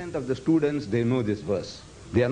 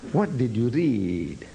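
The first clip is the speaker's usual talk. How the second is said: 1 slow question; 3 semitones lower, slower than usual. Retold or imitated.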